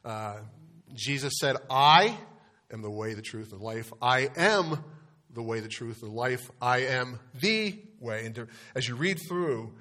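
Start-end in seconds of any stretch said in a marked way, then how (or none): 1.7-3.9 emphatic, loud "I" set apart from the phrase; stress on I. Shown as a demonstration. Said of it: "to emphasize, uh, the next word". 4.0-6.5 emphatic "am" set apart from what follows; stress on AM. Shown as a demonstration. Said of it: "to emphasize, uh, the next word". 6.6-8.3 "the" stretched out as a stressed "thee"; slow phrase with a drawn-out, emphatic "the". Shown as a demonstration. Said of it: "to emphasize, uh, the next word".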